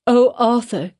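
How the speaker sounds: high, pleading cry of a name; a woman begging her lover, crying his name; cried plea. A narrator's reading. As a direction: cry out to him in anguish. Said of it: "cried she".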